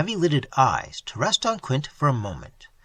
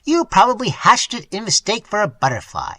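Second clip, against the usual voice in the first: loud, higher scoffing jab; mock him sharply; contemptuous retort; 7 semitones higher, 5 dB louder than usual. A narrator's reading. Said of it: "retorted Boomley".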